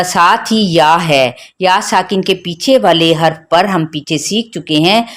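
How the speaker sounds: normal talking voice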